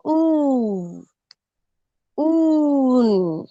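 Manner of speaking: ऊ and ऊन with the vowel drawn out long and steady. Shown as a demonstration. Teacher modelling the long vowel for children, drawn out. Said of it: "उच्चारण भी लंबा किया जाता है".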